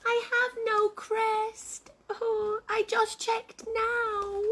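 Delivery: high, slow, sorrowful character voice; disappointed little egg. Acted. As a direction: lament slowly in a small high voice. The egg answers sadly.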